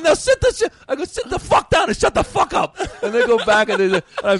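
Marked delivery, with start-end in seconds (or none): 0.0-2.8 much higher and louder stage order; commanding yell. Retold or imitated. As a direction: boss the crowd into silence.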